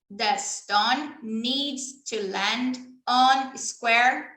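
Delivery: patient, slow instructions; slow teacher demo. Shown as a demonstration. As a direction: say each word slowly for a child. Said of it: "demo for a child".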